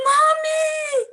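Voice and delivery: put-on very high, loud child's call; child calling Mommy. Her children run toward her calling, a high child's shout. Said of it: "the smiles coming towards me and calling".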